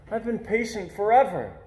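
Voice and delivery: put-on a boy whining that he has waited forever; childish whine. A narrator's reading. Impatient boy whining to his parents about waiting. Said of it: "I cry".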